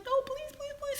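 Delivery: very high whiny pleading; mock begging. Woman begging him not to throw her out, whiny. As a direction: beg like a child.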